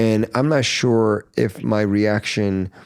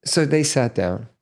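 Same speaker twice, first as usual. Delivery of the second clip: ordinary delivery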